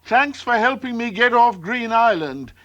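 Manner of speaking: high, carrying thanks; shouting. A narrator's reading. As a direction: call it out from a distance. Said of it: "he shouted".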